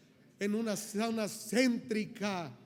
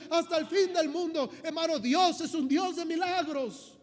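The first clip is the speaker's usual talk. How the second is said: rising, louder preacher's fervour, building toward the shout; preaching at full force; 4 semitones higher, 4 dB louder than usual. Acted. Pastor rousing the congregation about God's power, fervent building preaching. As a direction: proclaim God's power, swelling with every phrase.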